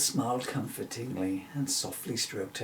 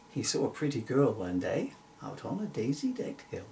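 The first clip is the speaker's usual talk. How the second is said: ordinary delivery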